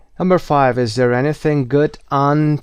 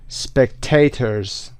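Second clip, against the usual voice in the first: "Spectators" very slowly, with -ta- stressed; stress on middle syllable; much slower than usual. Shown as a demonstration. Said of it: "قسمت وسط هست".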